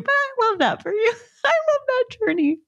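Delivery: high, fast, giggling through it; laughing. Real, not acted. Laughing at Rob's post, giggling high. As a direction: laugh while you say it.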